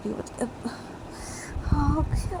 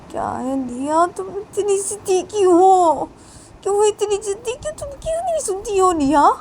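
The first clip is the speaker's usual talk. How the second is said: put-on higher, louder scolding of a stubborn child; exasperated scolding; 5 semitones higher, 3 dB louder than usual. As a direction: tell off the stubborn girl with rising pitch. (ज़िद्दी बच्ची पर ऊँची आवाज़ में झल्लाना)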